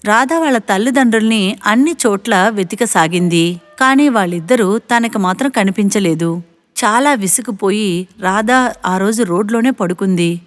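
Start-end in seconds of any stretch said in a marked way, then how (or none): none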